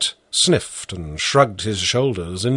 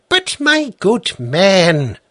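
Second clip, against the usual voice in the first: louder, higher, slow outburst; exasperated judge; 8 semitones higher, 4 dB louder, slower than usual. A narrator's reading. Judge loses patience with the peasant, higher and louder. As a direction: burst out in disbelief. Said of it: "burst out the judge".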